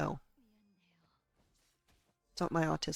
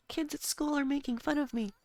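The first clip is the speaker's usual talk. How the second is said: put-on high, pleading kid voice; childish whine; 9 semitones higher than usual. Retelling her complaint to father-in-law in a high childish whine. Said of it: "it was like".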